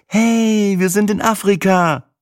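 high-pitched gleeful exclamation as Sina; excited cheer. Acted. Girlfriend to narrator on landing in Africa, excited. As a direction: cheer like a delighted traveller, voice way up.

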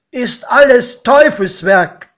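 the wary godfather declares it devil's work; godfather grumbling. A narrator's reading. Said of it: "meinte er".

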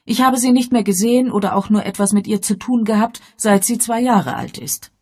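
high, loud, rushed protest; embittered mother Bess. Acted. Mother explaining her estrangement to the inspector, agitated and bitter.

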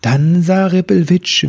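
ordinary delivery, nothing marked

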